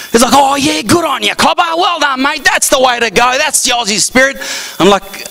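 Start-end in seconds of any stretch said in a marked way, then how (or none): 0.3-4.4 high, hearty mock Aussie praise; broad Australian accent. Aussie congratulating newcomer on his job, mock Australian accent. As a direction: imitate an Australian cheering on a mate. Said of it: "compared to their accent".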